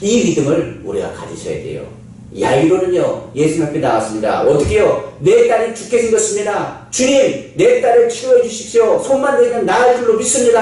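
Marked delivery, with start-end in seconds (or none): 5.2-10.6 high, urgent pleading; pleading father. Acted. Jairus begging Jesus to heal his daughter, desperate. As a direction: beg for your dying daughter.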